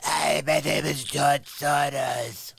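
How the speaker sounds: slow, put-on caricature voice; George Soros. Host mocking how a financier sounds, slow caricature impression. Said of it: "you guys know what he sounds like".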